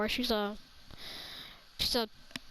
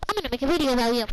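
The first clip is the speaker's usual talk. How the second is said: louder mock threat; 5 dB louder, much faster than usual. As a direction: repeat the threat louder, warning her. Threatening teacher, repeated line.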